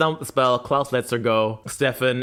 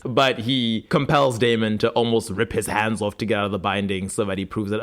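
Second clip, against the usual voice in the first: usual speaking voice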